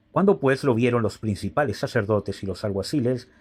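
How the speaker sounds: normal talking voice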